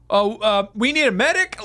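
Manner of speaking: high, feigned innocent concern; mock Samoa Joe. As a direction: play dumb, pitch rising high. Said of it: "Samoa Joe's like".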